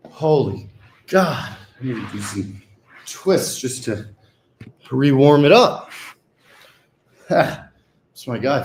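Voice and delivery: usual speaking voice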